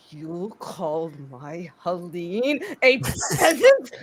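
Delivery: low seething disbelief. Acted. Grendel outraged at insult.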